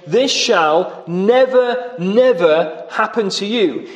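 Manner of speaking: slow, each NEVER leaned on hard; slow emphatic never, never; double negative stressed. Retold or imitated. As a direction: draw out "never, never" with weight. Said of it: "it's a double negative, which is a stronger negative".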